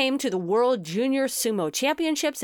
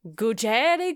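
Gughele in a mock Italian accent; fake Italian accent; slower than usual. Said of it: "a fake Italian accent on it".

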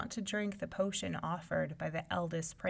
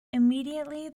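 ordinary delivery, nothing marked

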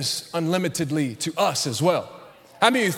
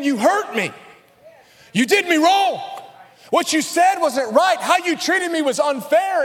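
preaching; 7 semitones higher than usual. Acted. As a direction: preach it with fire. Fiery sermon passage.

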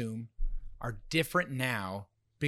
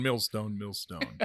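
fading echo; 5 semitones lower, 4 dB quieter, slower than usual. Pretend. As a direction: echo dying away, softer and lower. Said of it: "The echo thing".

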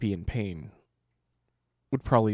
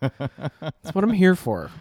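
slow, proud and loud; a hammy drawn-out boast; mock proud; 5 semitones higher, 5 dB louder, much slower than usual.

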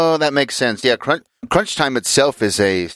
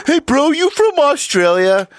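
much higher, louder dumb bro voice; clueless American bro; 4 dB louder than usual. Retold or imitated. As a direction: ham up the oblivious American. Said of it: "I thought he sounded Australian".

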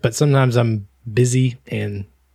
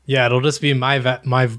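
ordinary talk

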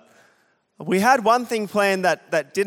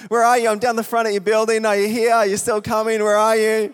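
raised pitch, insistent phone questioning; mock urgent calling; 4 semitones higher, 4 dB louder than usual. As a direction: imitate a stranded caller checking in. Acting out stranded guest phoning.

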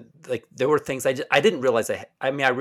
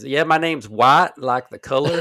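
slow, raised Southern drawl; thick Tennessee accent; 4 semitones higher, 6 dB louder, slower than usual. Retold or imitated. Quoting a guy introducing himself, thick Southern accent. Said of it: "I had a, a guy who's, who says".

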